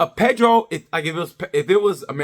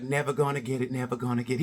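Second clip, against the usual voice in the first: softer, lower singsong taunt; taunting Mark; 5 semitones lower, 9 dB quieter than usual. Retold or imitated. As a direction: tease him quietly, never gonna get it. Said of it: "Mark was like".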